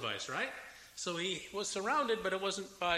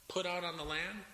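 ordinary talk

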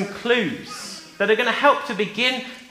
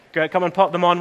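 usual speaking voice

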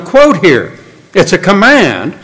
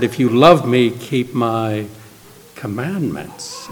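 much softer, fading to near silence on commandments; 6 semitones lower, 9 dB quieter, slower than usual. Retold or imitated. Preacher quoting Jesus to the children, hushed and fading. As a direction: let it fall away gently and slowly.

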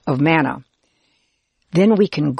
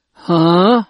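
louder, baffled huh; gulping huh; 5 dB louder than usual. A narrator's reading. Listeners baffled by the man's odd answer, gulping huh. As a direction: blurt it out, taken aback. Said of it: "We gulp and say".

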